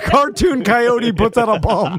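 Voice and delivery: slow, laugh-broken remark. Real, not acted. Co-host likens Butler to cartoon coyote, laughing. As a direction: struggle to get the joke out through laughter.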